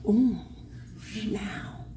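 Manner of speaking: a breathless whispered "now"; whispered dread. Retold or imitated. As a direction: let it die into a whisper. Dawning horror at the rule.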